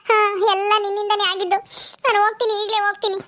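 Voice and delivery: a grown daughter quarrelling with her father, stung; upset daughter. Acted. Daughter Netra protests to her father, hurt and indignant. Daughter blames sister-in-law, leaving.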